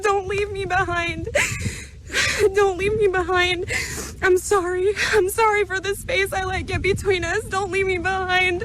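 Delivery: begging not to be left, crying; tearful plea. Real, not acted. Her spirit crying out to Jesus, tearful plea. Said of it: "my spirit is crying out".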